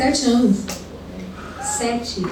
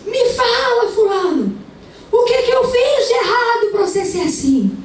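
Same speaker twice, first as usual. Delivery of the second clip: retold wailing yell; 10 semitones higher than usual. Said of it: "ela tava chorando aos berros".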